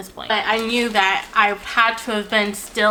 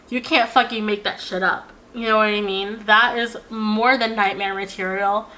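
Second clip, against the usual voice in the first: ordinary delivery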